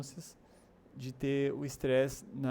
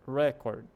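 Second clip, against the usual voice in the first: "Record" as REC-ord, opening punched louder; first-syllable stress; 5 dB louder than usual. Shown as a demonstration. Teacher explains capitals mean emphasis, "Record" stressed up front. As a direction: lean hard on the start of "Record". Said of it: "maiúsculo significa ênfase".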